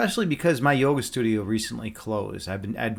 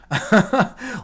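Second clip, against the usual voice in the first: short, higher-pitched laugh; amused laugh; 9 semitones higher, 5 dB louder than usual. Real, not acted. Streamer admitting to grilling in winter, amused laugh. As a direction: laugh at your own habit.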